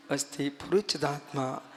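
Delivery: soft, low meditative recitation. Shown as a demonstration. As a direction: say it inwardly, hushed and low, as if sitting in meditation. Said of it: "अब ध्यान करने बैठो". (ध्यान में धीमे, मंद स्वर में)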